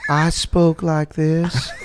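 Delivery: slow drawling Southern belle; coy Southern belle, slowed right down. Shown as a demonstration. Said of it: "Can you do the voice?".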